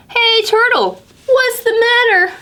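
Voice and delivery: high, concerned call; Pete calling out. Acted. Pete asking Turtle what's wrong, high and caring. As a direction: check on a friend.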